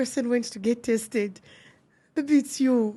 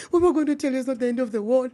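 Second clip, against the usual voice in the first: rushed counsellor line; much faster than usual. Retold or imitated.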